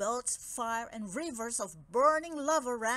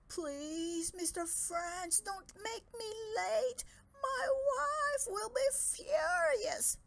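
high, slow, whimpering plea; terrified pleading; 11 semitones higher, slower than usual. Acted. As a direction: beg in a frightened, crying dragon voice. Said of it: "Dragodad was terrified".

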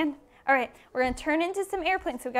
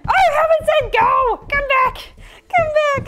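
high, loud recall; playful shouting; 7 semitones higher, 7 dB louder than usual. Real, not acted. Teacher calls back children who ran early, playful shout.

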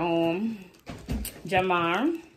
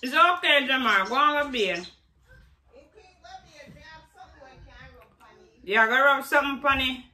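loud, higher-pitched call; raised voice giving instructions; 8 semitones higher, 6 dB louder than usual. Real, not acted. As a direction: send him off from across the room. Calling instructions across to Jamar.

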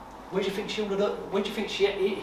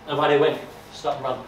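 ordinary delivery

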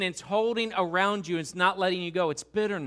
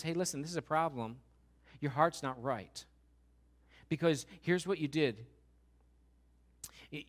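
God speaking softly; 6 semitones lower, 7 dB quieter than usual. Acted. God voiced confronting Cain quietly.

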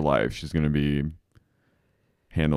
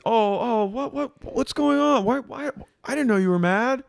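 very high, stammering imitation of innocence; mock clueless; 13 semitones higher than usual. Acting out Tom reacting to Katie, mock clueless. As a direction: act flustered and oblivious, voice way up.

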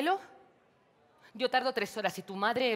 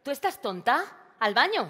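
loud, high, outraged disbelief; indignant outburst; 7 semitones higher, 10 dB louder than usual. Acted. María, outraged at her sister's suggestion, shouts in disbelief. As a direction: fire it back, scandalized.

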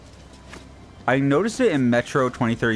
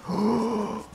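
sharp high gasp of delight; excited gasp; 11 semitones higher, 4 dB quieter than usual. Real, not acted. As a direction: gasp in thrilled surprise. Finds rare weapon.

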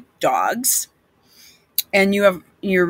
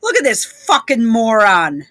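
ordinary talk

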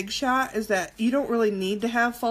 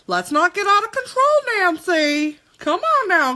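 sassy scolding; 8 semitones higher, 5 dB louder than usual. Pretend. Sassy scolding of viewer Nancy.

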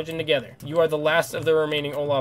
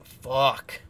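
loud frustrated curse; 10 dB louder than usual. Real, not acted. Gamer sees too many defenders and curses loudly. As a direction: blurt it out in frustration. More defenders than expected.

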